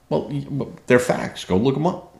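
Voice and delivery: ordinary delivery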